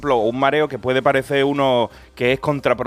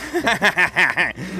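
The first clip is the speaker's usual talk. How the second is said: real laugh; 4 semitones higher than usual. Real, not acted. Laughing about rats.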